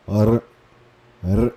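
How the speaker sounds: "R" rasped in the throat; guttural R. Shown as a demonstration. Teacher demonstrates the guttural R. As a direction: produce "R" from the back of the throat. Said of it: "um R mais produzido".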